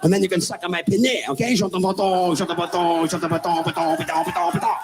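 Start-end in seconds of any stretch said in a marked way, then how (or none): 1.5-4.8 sing-song fake French babble; hammed fake French; mock French gibberish. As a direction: mangle it like silly French.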